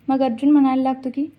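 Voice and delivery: usual speaking voice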